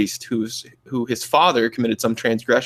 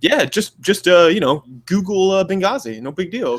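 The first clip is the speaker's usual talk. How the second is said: higher, breezy mock reassurance; mock conspiracy theorist; 7 semitones higher than usual. Mocking a conspiracy theorist's breezy dismissal, higher voice. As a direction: wave it off like it's nothing. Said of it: "gonna be like".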